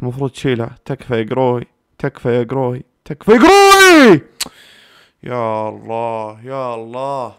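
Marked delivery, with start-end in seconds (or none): 3.0-4.2 very high, loud, drawn-out plea; pleading scream. Real, not acted. Fan begging Grohe for a save, pleading scream. 5.2-7.3 soft, slow, stretched words; subdued drawn-out plea. Real, not acted. Fan after the penalty kick, subdued drawn-out plea.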